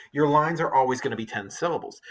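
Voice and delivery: normal talking voice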